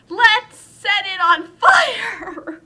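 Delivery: slow, high, loud shouted idea; hammed-up crowd shouting a daft plan; gleeful yell. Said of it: "And everyone's like".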